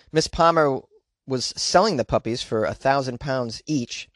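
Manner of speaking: ordinary talk, nothing marked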